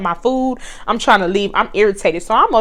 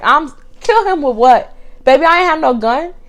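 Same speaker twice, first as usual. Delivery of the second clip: normal talking voice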